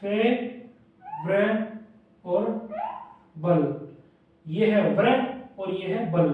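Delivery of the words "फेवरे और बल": फेवरे drawn very slow, then बल apart; teacher stretches फेवरेबल in parts; syllable split. Shown as a demonstration. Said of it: "फेवरेबल".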